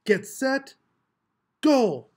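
high, loud start call, punching go; starter shout. A narrator's reading. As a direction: fire off the start. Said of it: "Bump jumped into the let-up".

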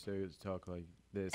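soft, fading imitation of a tired man; sleepy Scary. Mimicking a co-worker getting tired before nap time, sleepy. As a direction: trail off softly as if nodding off. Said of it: "he's getting tired because he just starts".

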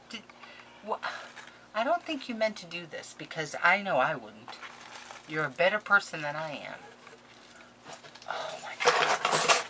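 usual speaking voice

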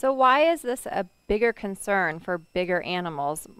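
normal talking voice

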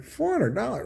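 high, incredulous rising repeat of Four hundred dollars; shocked disbelief. Retold or imitated. Customer balking at the massage parlor price, high and incredulous. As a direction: balk at the price in mock shock. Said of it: "You're like".

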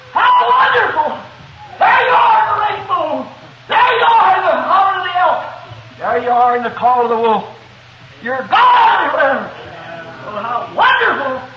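retold worship shout, nearly real, with a laugh breaking through; shouting praise. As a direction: cry it out to the mountains at full voice. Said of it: "shouting top of my voice".

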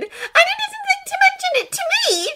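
very high, loud indignation; outraged demand. A narrator's reading. Hermione complaining Draco kept the secret, outraged. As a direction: demand it at a squeal. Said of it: "she demanded".

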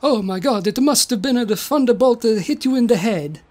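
amazed mock-Italian inventor. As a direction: exclaim like a cartoon Italian. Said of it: "stereotypical Italian accents".

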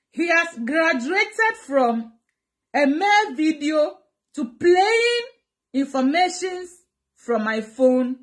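very slow, measured accusation; slow, indignant emphasis. Real, not acted. Vlogger accusing her ex of crossing boundaries, slow and emphatic. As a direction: stretch out each phrase for weight, indignant. Indignant list of David's offence.

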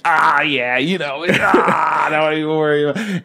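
high, laughing, casual mock brush-off; laid-back brush-off. Sidekick brushing off the guard, high and laughing. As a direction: wave it away with a laugh. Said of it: "by the end of it, he's like".

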